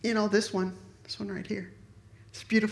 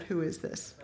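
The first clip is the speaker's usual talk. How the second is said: low, softer, suspicious question; stern demand; 5 semitones lower, 7 dB quieter than usual. Retold or imitated. Mother confronting the friend on the phone, low and suspicious. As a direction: drop it low like a mom who knows. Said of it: "And I said".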